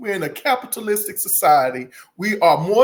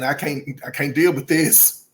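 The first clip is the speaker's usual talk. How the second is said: usual speaking voice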